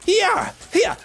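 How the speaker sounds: high, far-off squeaky yeah yeah; mock cheer. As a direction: chirp it like a tiny creature. Mimicking game character cheer.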